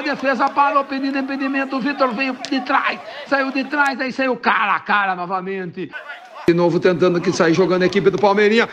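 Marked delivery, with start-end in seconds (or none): 6.5-8.7 football commentary building to a goal shout; live play-by-play. Acted. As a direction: call the attack rising to GOL.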